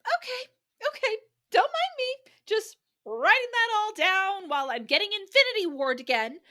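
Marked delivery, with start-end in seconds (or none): 1.5-6.4 high sing-song fake innocence; mock innocent. Teasing the co-host about secret letters, high mock innocence. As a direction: pretend you're not plotting at all. Sarcastic mock note-taking.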